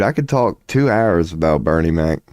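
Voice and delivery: usual speaking voice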